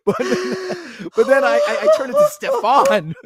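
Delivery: laughing. Real, not acted. Laughing about his voice.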